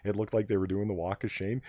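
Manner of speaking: normal talking voice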